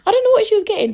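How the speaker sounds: I don't know what she was getting in a high squeal; high, loud mock-baffled squeal; squealed repeat. Shown as a demonstration. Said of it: "repeated much higher".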